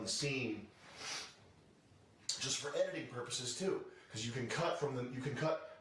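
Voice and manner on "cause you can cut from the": ordinary talk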